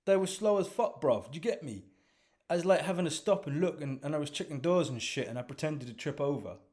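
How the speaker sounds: put-on a cocky young lad explaining himself; young London lad. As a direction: brag to the team like a teenager. Mo's young street voice.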